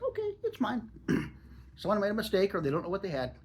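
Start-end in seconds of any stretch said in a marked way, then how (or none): 0.0-0.9 squeaky high mock voice, claiming the bargain; a gleeful grab, pitched way up; mock greedy claim. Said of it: "It's like".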